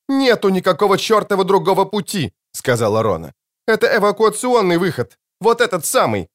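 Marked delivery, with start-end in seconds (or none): 0.1-2.3 high, insistent, exasperated retort; heated objection. Acted. Officer rejecting any talk of another way, high and heated. 3.7-6.3 high-pitched, agitated arguing; agitated insistence. Acted. Officer arguing this is the evacuation exit, high and agitated.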